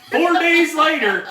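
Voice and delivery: ordinary talk, nothing marked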